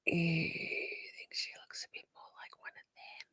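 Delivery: hushed snide whisper. Real, not acted. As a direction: lean in and whisper it. Snide aside over court footage.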